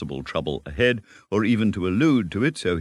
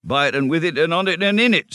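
louder, higher, eager Rat; the proud Rat rattling it off with gusto; Rat, excited; 11 semitones higher, 5 dB louder than usual. Acted. Rat boasting about his river.

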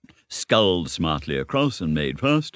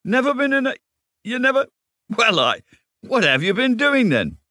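very high, louder incredulous cry; open-mouthed astonishment; 5 dB louder than usual. A narrator's reading. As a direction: splutter it in broken, disbelieving bursts. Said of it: "cried the rat, open-mouthed".

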